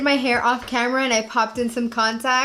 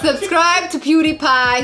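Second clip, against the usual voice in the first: loud mock plug; 5 semitones higher, 6 dB louder, slower than usual.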